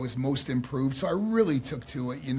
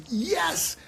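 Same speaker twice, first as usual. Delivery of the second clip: high, drawn-out yes; retold emphatic yes; 8 semitones higher than usual. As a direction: mimic someone delighted you finally got it.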